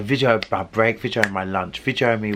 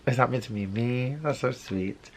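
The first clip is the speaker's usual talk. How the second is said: softer, a little flattered and touched; 6 dB quieter than usual. Retold or imitated. Her reply to his romantic message from the London streets, soft and touched. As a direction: answer the sweet message gently, almost to yourself.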